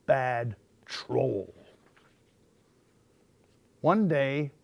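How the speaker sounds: plain reading voice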